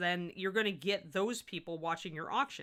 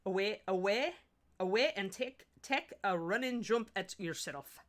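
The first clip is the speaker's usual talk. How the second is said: deliberate, drawn-out Scottish accent; amateur Scottish accent, words spaced out slowly. Shown as a demonstration. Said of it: "It's probably a horrible Scottish accent".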